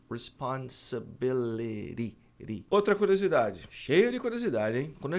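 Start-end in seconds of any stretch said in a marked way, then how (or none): 0.1-2.2 slow "Responsibility" with the T flapped to a quick D; "Responsibility" drawn out, the T softened into a tap; slow American demo of "Responsibility", the T tapped. Shown as a demonstration. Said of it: "transformar esse T em E".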